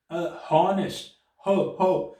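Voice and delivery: slow "हॉनेस्ट", then its "हो" opening isolated; H sounded. Shown as a demonstration. Teacher showing why "a" would fit, H sounded in "हॉनेस्ट". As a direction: pick out the H in "हॉनेस्ट" and "हो". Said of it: "अगर हम इसको pronounce करेंगे हॉनेस्ट".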